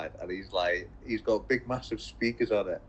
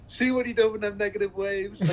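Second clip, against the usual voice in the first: mock accusing Oddball; 12 semitones higher than usual. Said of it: "he's like".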